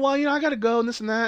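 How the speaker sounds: high, hurried fake-casual excuse; mock nervous excuse. Retelling Beck's nervous excuse to Joe, pitched high. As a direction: squeak it out like you're trying to slip away.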